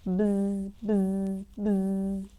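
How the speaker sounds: droning buzz with lips; buzzing bees. Pretend. Playing the bees for young listeners, droning buzz. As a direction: buzz like a bee.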